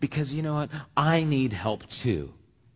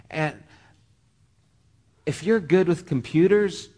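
ordinary talk, nothing marked

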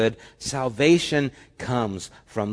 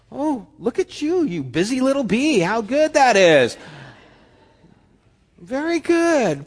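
high, sing-song pat on the head; patronizing praise; 11 semitones higher, 4 dB louder than usual. Acted. God praising the busy believer, high patronizing coo. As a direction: coo at them like a proud parent to a toddler.

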